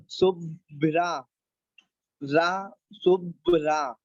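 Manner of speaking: शुभ्रा said slowly with half भ and full रा; teacher spelling out शुभ्रा slowly; slow model word. Shown as a demonstration. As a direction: stretch शुभ्रा, giving रा its full sound. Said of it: "रा का इसका पूरा उच्चारण होगा".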